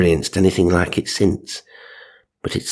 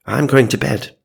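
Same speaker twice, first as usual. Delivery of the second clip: friend turning in; 6 semitones higher than usual. Acted. Tries out friend's line.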